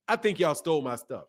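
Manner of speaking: normal talking voice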